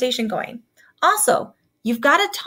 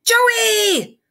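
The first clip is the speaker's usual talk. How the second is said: loud high call of the name; blaring shout; 10 semitones higher, 5 dB louder than usual. A narrator's reading. Principal shouting after the fleeing bully, blaring call. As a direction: bellow his name down the hall. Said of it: "blared Mister McDonald".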